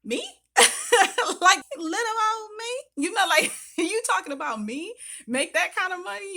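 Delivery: high, loud squeal of mock amazement with laughter; mock disbelief. Telling of the recruiter's offer, high laughing mock disbelief. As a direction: play it like you can't believe anyone picked you.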